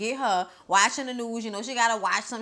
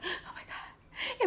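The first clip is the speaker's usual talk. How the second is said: high whispered gasp of shock; imitating Sydney's shocked whisper; breathless whisper; 13 semitones higher, 12 dB quieter, much slower than usual. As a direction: whisper it in horror. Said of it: "she was like".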